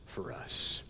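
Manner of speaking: breathy whispered close. Real, not acted. Preacher ending his sermon, whispered. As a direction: hush it to a whisper.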